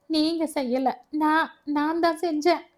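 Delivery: tearful. A narrator's reading. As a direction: blame yourself, on the verge of crying. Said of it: "மறுபடி குலுங்கி அழ ஆரம்பித்தாள்". (அழுகை கலந்த குரலில்)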